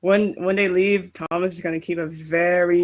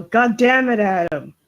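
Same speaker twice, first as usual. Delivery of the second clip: louder, higher exasperated yell that drops on the name; acted angry yell; 4 semitones higher, 5 dB louder than usual. Thomas yelling at Adam across a distance, exasperated yell. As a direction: yell it in character, fed up. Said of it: "he's gonna yell".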